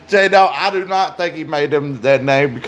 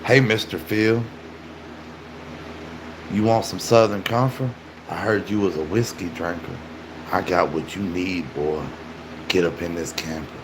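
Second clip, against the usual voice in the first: creepy low voice; 11 semitones lower, 9 dB quieter than usual. Pretend. Pitching Southern Comfort to her dad, low and creepy. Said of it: "My girlfriend hates it when I do that voice".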